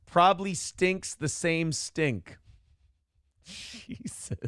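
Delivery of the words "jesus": breathy laugh on the word. Real, not acted. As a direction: laugh through it. Laughing at own joke.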